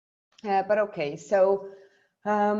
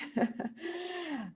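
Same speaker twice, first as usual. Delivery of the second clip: brief nervous laugh; light embarrassed laugh; short laugh; 11 semitones higher, 9 dB quieter than usual. Real, not acted. Nervous laugh before talk.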